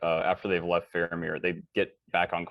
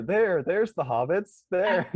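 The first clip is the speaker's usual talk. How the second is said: high, slow cartoon pointing voice; mock kids' TV host calling out the hobbits, high and slow; Dora the Explorer; 12 semitones higher, 4 dB louder, slower than usual. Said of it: "like, Dora the Explorer".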